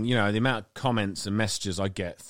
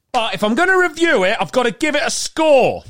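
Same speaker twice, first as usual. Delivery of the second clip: loud, high showman build-up; dramatic announcement; 9 dB louder than usual. Acted. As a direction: announce it like a game show host. Dramatic build to review score.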